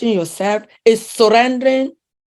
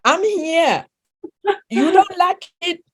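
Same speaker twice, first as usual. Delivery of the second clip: high, bold and proud, owning it; proud defiance; 8 semitones higher than usual. Real, not acted. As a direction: declare it like a proud challenge. Proud defiant self-declaration, laughter.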